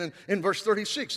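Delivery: usual speaking voice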